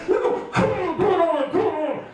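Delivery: high, exasperated big brother; hammed-up annoyed urging; really annoyed. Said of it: "my brother would get really annoyed".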